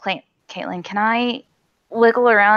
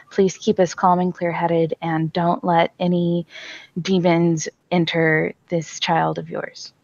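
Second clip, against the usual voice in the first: prayer; 4 semitones lower than usual. Acted. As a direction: pray over him steadily for protection. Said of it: "I am saying".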